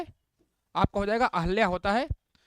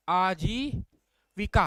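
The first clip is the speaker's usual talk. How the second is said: आजीविका drawn out syllable by syllable; very slow; much slower than usual. Shown as a demonstration. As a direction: spell आजीविका out slowly for students. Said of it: "है ना".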